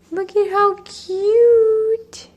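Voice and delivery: high, slow, gushing coo. Real, not acted. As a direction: melt over the cute cat. Cooing over cat.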